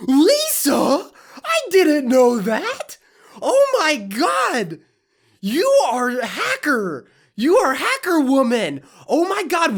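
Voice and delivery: high, loud, fake-astonished squeal; mock amazement. Mocking Lisa's obvious tip with fake astonishment. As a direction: overplay shock like a fan.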